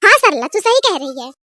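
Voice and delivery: ordinary talk, nothing marked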